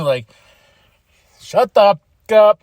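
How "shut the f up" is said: louder mock jeer from the crowd. Fan telling Ricky to shut up, in a louder mock yell. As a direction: bark it like an angry fan.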